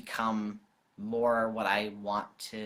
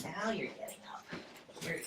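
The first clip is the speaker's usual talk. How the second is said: pet talk; 6 semitones higher, 9 dB quieter than usual. Real, not acted. Teasing the dog in pet voice.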